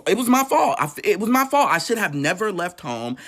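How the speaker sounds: high, loud, anguished outburst; anguished cry. Real, not acted. Narrator recalling the beating, crying out in guilt. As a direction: cry it out as self-blame. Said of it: "I felt like".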